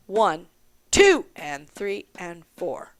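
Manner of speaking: slow count, lean hard on "two" and let the rest fall away; accent on two. Shown as a demonstration. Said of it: "we have an accent on two".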